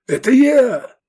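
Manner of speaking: high, loud, choked cry; strangled shout. A narrator's reading. Legionary stops his friend's attack, strangled shout. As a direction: cry out urgently through a tight throat. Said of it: "сдавленно крикнул легионер".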